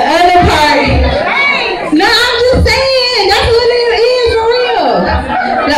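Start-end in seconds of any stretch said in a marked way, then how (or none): 1.9-4.9 excited insisting. Real, not acted. Insisting amid laughter.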